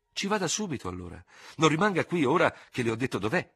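high, flustered outcry; exclaimed order, pitched well up; crying out. A narrator's reading. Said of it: "gridò la ragazza arrossendo".

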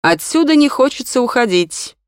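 high, lifted, dreamy reply. A narrator's reading. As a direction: sound lightly lost in reverie. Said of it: "мечтательно ответил молодой учитель".

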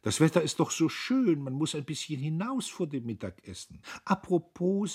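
high-pitched, fluent social chatter; glib. A narrator's reading. Sister chats to the visitor before lunch, glib and high. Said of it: "sagte sie geläufig".

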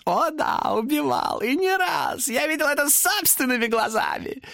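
high cackling demon voice; laughing demon. A narrator's reading. Demon gloats to Thomas about killings. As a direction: relish it with a cackle. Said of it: "засмеялся демон".

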